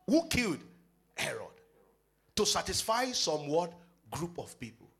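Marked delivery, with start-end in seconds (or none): none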